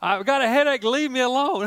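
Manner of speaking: a hint of a whiny three-year-old; mock the toddler's grumbling again; grumpy toddler. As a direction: grandfather repeating the toddler's line, lightly whiny. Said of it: "I mean, he said".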